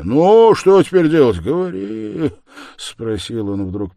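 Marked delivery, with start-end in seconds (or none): 0.0-2.3 loud, high, desperate question; despairing demand. A narrator's reading. Man asking Sonya what to do, despairing. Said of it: "с безобразно искажённым от отчаяния лицом".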